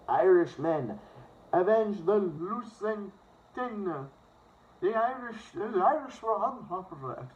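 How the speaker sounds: high-pitched, bad Irish accent; butchered Irish accent. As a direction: put on a hammed-up Irish accent. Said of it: "Sorry if I butchered that Irish accent".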